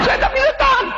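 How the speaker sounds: high pleading cry; desperate plea. Acted. Head nurse begging junior nurse, high pained plea. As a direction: beg in pain, voice up high.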